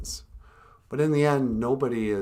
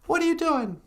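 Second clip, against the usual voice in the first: puppet voice; 6 dB louder than usual. Acted. Puppet Natasha introducing herself, very high and loud. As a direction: squeak it out as the puppet.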